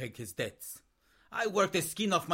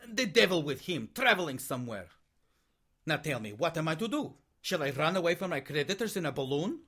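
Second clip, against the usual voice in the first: ordinary delivery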